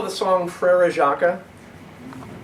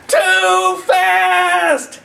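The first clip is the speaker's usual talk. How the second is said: much higher, louder panicked shriek; 10 dB louder than usual. Acted. Mom sees Fred speed downhill, panicked shriek. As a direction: scream it like a terrified mom. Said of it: "Mom shrieked".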